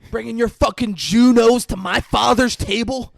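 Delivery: loud, high angry rant; hammed-up furious yelling. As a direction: rant at him across the dinner table. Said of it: "He goes".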